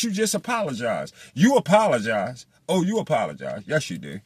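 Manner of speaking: ordinary talk, nothing marked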